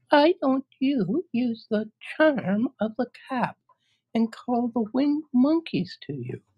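very high, tiny mouse squeak; squeaky little voice. Acted. Queen of the Mice offering help, high squeak.

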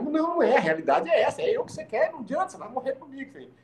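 put-on retelling his cocky line to the girl, boastful. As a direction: brag like you can't lose.

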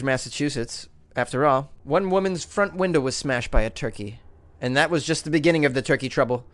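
usual speaking voice

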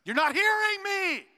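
high, drawn-out yell of frustration; exasperated spouse. Acted. Spouse in a heated argument, exasperated shout. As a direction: yell it slowly at a partner who won't listen. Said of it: "intense fellowship with your spouse".

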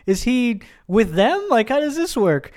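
high, baffled questioning; confused alarm. Retold or imitated. His first reaction to the twist, high and confused. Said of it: "my reaction was".

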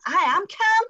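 high, loud mock outburst; indignant shout tipping into laughter; shouted denial. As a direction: yell that you're calm, comically not calm. Shouts back when told calm down.

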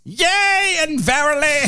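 put-on high, old-fashioned herald voice; town crier. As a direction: answer like a royal herald. Joins mock herald game.

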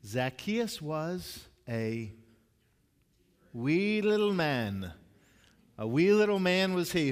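slow, lilting mock-Irish children's rhyme; Irish accent. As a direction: recite it slowly with a put-on Irish accent. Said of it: "trying to say that with an Irish accent".

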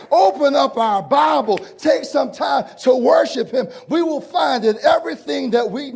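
loud, high preaching shouts; full-force preaching. Acted. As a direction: lift the church with each line. Preacher building to climax.

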